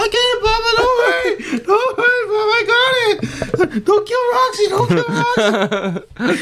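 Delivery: very high, wailing child voice, hammed-up; mock crying plea. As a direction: beg grandpa in a fake sob. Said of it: "I start crying".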